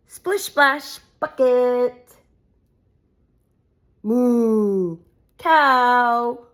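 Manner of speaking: lively sing-song picture-book reading; reading to children. Acted. Mum reading a farm book to her kids, animated. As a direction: read it out brightly for little ones.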